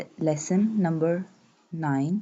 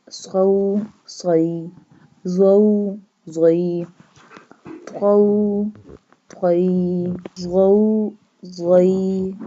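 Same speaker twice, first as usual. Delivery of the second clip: syllable drill; 5 dB louder, much slower than usual. Shown as a demonstration. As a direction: chant each letter, vowel and syllable for students to repeat.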